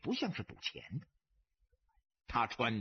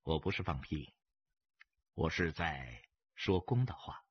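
low, unruffled reply; detached, understated calm; coolly indifferent; 10 semitones lower than usual. A narrator's reading. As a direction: answer the insult calmly, deep in pitch. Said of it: "淡淡地说".

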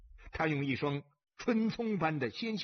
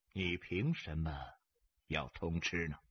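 low, unruffled question; quiet, detached needling; calm challenge; 10 semitones lower, 4 dB quieter than usual. A narrator's reading. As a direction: ask it coolly, deep in pitch. Calm stranger questions the gambler.

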